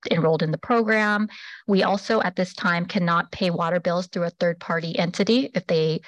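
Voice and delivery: usual speaking voice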